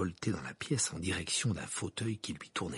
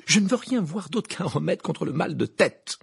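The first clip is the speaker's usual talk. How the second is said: curt interruption; 6 semitones higher, 6 dB louder than usual. Acted. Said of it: "l'interrompit Harry".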